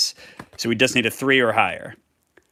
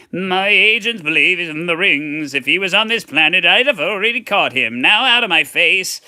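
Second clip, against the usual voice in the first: higher, put-on blustering frog official; bad frog voice; 5 semitones higher, 4 dB louder than usual. Undersecretary dismissing the crew, higher, irritated frog voice. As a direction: cut them off in a silly frog voice. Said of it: "that's not a good frog voice".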